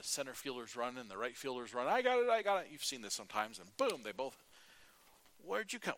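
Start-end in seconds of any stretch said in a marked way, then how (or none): 1.9-2.7 high, loud "I got it" call; an outfielder shouting to claim a pop fly. Retold or imitated. Outfielders calling for the ball. 5.4-5.9 mild surprise. Retold or imitated. Fielders collide, one exclaims.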